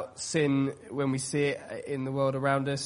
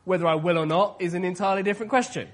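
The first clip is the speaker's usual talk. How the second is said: put-on louder, quick grumpy teen comeback; grumpy teenager; 3 semitones higher, 6 dB louder, faster than usual. Preacher as his teenage self answering mum back, grumpy teenager. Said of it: "my best grumpy teenager impression".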